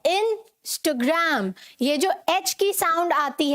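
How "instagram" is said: "Instagram" very slowly, middle A reduced to a short uh; corrected pronunciation. Shown as a demonstration. Teacher models the word for class, "Instagram" slowly as in-stuh-gram. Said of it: "that is क्या है pronunciation?".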